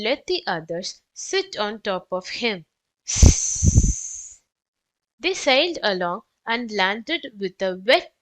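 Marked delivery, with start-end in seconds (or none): 3.1-4.4 loud hissing slide sound; sliding hiss. A narrator's reading. Narrator makes the slippy slide sound, loud hiss.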